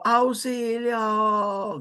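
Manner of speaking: high, drawn-out cry of auxilio; a drawn-out, high mock cry for help; shouting for help. Shown as a demonstration. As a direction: call out auxilio as if in danger.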